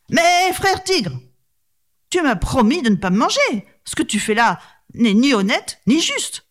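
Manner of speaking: reproche aigu, lent au début; brahman indigné. Acted. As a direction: proteste contre la trahison. (brahman qui reproche au tigre sa trahison)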